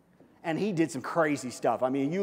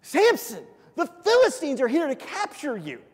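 high, loud mock alarm call; hammed-up high-pitched yell; acted yell; 9 semitones higher, 5 dB louder than usual. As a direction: cry out a warning as Delilah. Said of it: "and then she yells".

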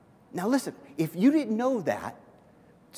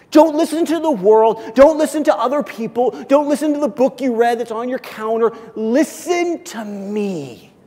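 raised, high preacher's plea as God; urgent pleading; 4 semitones higher, 6 dB louder than usual. Acted. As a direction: plead loudly in repeated bursts, then stretch out the last appeal. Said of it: "God is pleading".

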